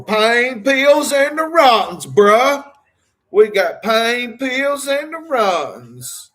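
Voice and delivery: lightly hammed-up Hinkle impression, bruh tacked on; Darryl Hinkle voice. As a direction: stretch it out high like you're doing Daryl Hinkle. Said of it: "I sound like Darryl?".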